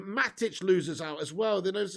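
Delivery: normal talking voice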